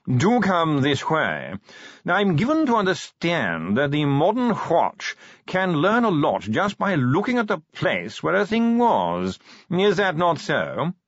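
slow, high, languid aristocratic voice; posh yawning drawl. Acted. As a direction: drawl every word like a yawn. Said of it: "modulated yawning".

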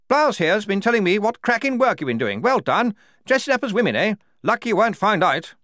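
high, loud, bluff officer's voice; booming jolly commander; hearty major. A narrator's reading. The major greeting the squad, loud and bluff.

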